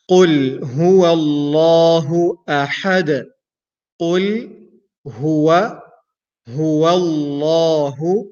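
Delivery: slow, careful Quran recitation line by line. Shown as a demonstration. Teacher reciting slowly for students to repeat. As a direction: recite phrase by phrase for students to copy. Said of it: "So you can repeat after me".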